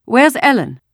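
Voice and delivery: high, loud alarmed question; frightened. A narrator's reading. Missus Rosen asks after her daughter, frightened. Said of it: "had a frightened look".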